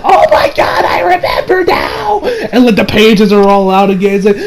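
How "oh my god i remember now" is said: high, breathy gasp of remembering; sudden realization. Retold or imitated. Recapping the scene, Koichi jolting in high surprise. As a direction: snap to the memory with a high yelp.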